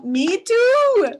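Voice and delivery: high, loud squeal; excited squeal. Real, not acted. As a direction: squeal it with delight. Squeals agreement with the ad copy.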